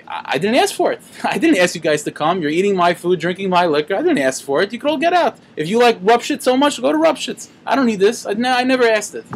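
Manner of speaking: very upset rebbe. Retold or imitated. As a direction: play a fed-up host rising to a high complaint. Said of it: "he got very, very upset".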